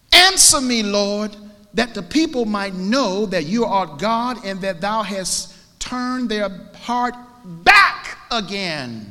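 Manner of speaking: high, loud, slowing cry; impassioned preached prayer. Acted. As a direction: call on the Lord at full force, stretching the end. Reads prayer at full preaching force.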